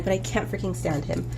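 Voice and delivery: usual speaking voice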